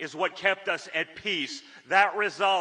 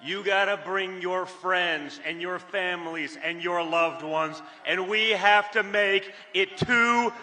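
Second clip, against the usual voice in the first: rousing get-out-the-vote rally call; rally speech. Acted. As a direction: urge the crowd to the polls. Urges the crowd to vote.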